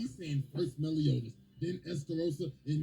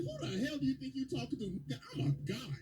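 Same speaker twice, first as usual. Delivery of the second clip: higher-pitched offended retort; mock indignation; 9 semitones higher than usual. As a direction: play the insulted big shot, pitched up. Acted quote, indignant at being refused.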